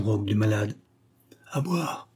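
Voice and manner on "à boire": a faint, higher, softer plea of À boire; stifled sick man's plea; muffled voice. A narrator's reading. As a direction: barely get the words out, muffled. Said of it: "fit-il d'une voix étouffée".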